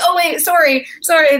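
lightly flustered, retold; flustered apology. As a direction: apologise in a hurry, pitch up.

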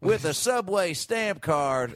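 slow, high sing-out joke setup; Jeff Foxworthy. Acted. Co-host showing how those jokes go, slow impression. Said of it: "It's usually like".

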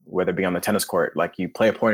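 ordinary talk

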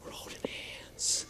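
hushed, gasping whisper of delight; awed whisper. Retold or imitated. Teen boy holding hands in the maze, whispered. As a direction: breathe it like a secret thrill.

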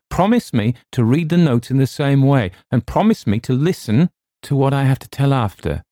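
insistent demand. A narrator's reading. Narrator extracting a soldier's promise from his cousin, insistent. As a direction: press each demand home.

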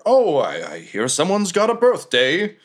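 hammed-up chef, pitch swinging high; chef's birthday greeting. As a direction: play a cook putting on a show. Cook greets captain as chef.

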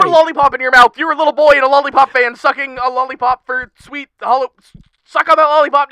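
loud, high frantic orders; urgent shouting. Acted. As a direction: bark urgent instructions. Shouting instructions in panic.

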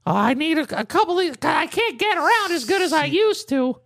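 high-pitched elderly impression, angling for help; feeble old man. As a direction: drag it out as a mock frail old man. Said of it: "he's like".

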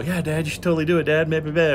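put-on high, quick, eager kid voice; excited kids voice. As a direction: beg like an excited child. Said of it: "the kids were all".

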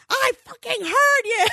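high, mock-furious shout back; angry yell back. Husband answering wife from downstairs, angrily shouting. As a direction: imitate an irritated husband hollering back. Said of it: "We're so angry with one another".

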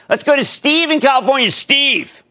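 host bringing the caller on air, calling out his name; show-host call-out. Acted.

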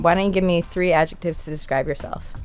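ordinary talk, nothing marked

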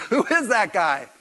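loud, high, amused wondering; mock bewildered question with a laugh in it; puzzled fishermen. Acting the puzzled fishermen looking back.

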